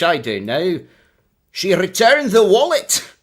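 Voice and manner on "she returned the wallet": high, loud Scottish reading; Scottish accent. Shown as a demonstration. Streamer reads the yearbook line in a Scottish accent. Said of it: "I've gotta do Scottish".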